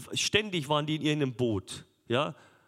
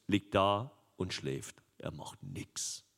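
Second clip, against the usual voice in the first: much softer, slow, fading into a whisper on "Er macht nix"; dropped to whisper; 6 semitones lower, 6 dB quieter, slower than usual. Acted. Preacher to congregation, hushed whisper. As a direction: let it sink to a hush. Dramatic drop on sleeping Jesus.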